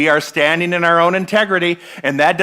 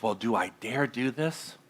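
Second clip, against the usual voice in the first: doubtful self-question; 4 semitones lower, 9 dB quieter than usual. Retold or imitated. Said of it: "you're asking yourself".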